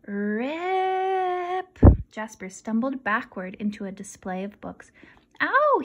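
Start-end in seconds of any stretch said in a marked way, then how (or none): 0.1-1.8 high voiced rip; ripping sound. Narrator voicing the map tearing, high. 5.4-5.8 high, loud yelp; yelp of pain. A narrator's reading. Jasper stumbling into the books, a high loud yelp. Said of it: "he yelped".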